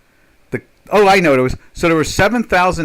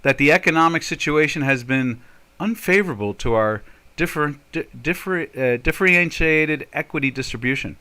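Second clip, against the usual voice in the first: usual speaking voice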